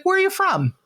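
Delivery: very high, lilting question; Keith Morrison. Retold or imitated. TV idol asks fan about home, high lilting voice. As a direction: swoop up with friendly interest. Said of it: "He's like".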